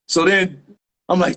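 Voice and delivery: ordinary talk, nothing marked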